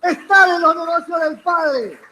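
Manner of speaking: high-pitched, insistent pulpit delivery; forceful preaching. Real, not acted. Preacher urging the congregation to attend to the Father's business, insistent high preaching. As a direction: drive each point home to the congregation.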